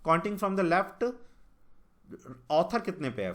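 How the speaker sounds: reading the English line out word by word, slowly; slow question read. Shown as a demonstration. Teacher slowly reads the puzzle statement to students, deliberate.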